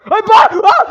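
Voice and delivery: very high, loud shriek; sudden fright scream; startled scream. Real, not acted. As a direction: jump out of your skin. Sudden jumpscare.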